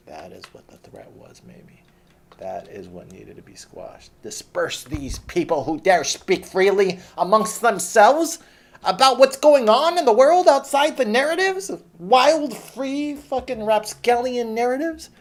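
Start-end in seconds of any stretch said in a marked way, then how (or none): 4.2-15.1 high, hammed-up official sneer; mock the authorities, high and theatrical; mock pompous authority. Mocking how the authorities saw free-talking protesters, high and hammed-up.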